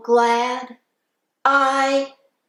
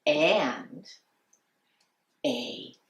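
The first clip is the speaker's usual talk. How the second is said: lilting, sing-song storytime voice; reading to children. Acted. Teacher reading The Little Red Hen to class, storytime voice. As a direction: read it like a kindergarten teacher.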